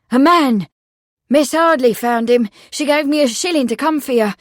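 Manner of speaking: put-on high, loud boy with news; excited. A narrator's reading. The boy tells the constable who sent him, excitedly. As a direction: blurt the news out eagerly.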